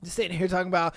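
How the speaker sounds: ordinary talk, nothing marked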